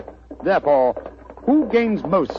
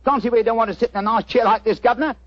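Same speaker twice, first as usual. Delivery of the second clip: chirpy cockney delivery pitched up; cockney disguise; 6 semitones higher, faster than usual. Acted. Disguised detective greets the homeowner in cockney. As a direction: play a cheeky London workman. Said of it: "That's rhyming slang".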